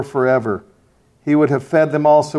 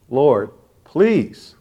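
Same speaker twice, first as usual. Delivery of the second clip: emotional pleading; slower than usual. Real, not acted. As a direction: cry out to God, voice cracking. Prayer plea breaking with emotion.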